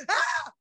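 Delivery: high startled "Ah!" yelp; mock shriek. What she must not do on seeing Michael, high mock shriek. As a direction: shriek as if frightened by a face. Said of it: "I don't wanna go".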